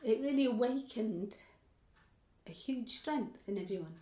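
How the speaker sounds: real emotion welling up, holding back crying; close to tears. Real, not acted. Woman recalling strength found at Greenham, close to tears. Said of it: "it's making me cry".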